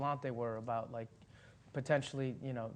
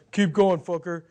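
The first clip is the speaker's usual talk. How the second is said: loud mock director yell; retold yell; 4 semitones higher, 15 dB louder than usual. Director calling to actors from off set, loud mock yell. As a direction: bark it like a director off camera.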